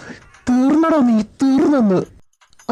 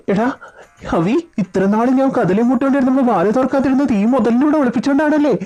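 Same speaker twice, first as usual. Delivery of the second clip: ordinary talk, nothing marked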